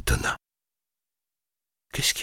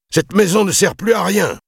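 high, arrogant lordly voice; imperious; 4 dB louder than usual. A narrator's reading. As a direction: dismiss them from on high. Lord curtly dismisses the builders. (seigneur hautain, voix haut perchée)

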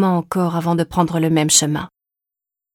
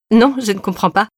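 teasing retort; 3 semitones higher, 4 dB louder than usual. A narrator's reading. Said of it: "répliqua aussitôt Laetitia".

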